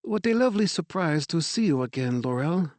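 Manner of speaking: a gracious French director's greeting; French accent. A narrator's reading. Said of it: "her English marked with a French accent".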